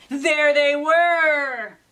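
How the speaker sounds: slow, loud, drawn-out reveal; big, slow storybook shock; dramatic reveal. A narrator's reading. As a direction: boom it out like a jump scare.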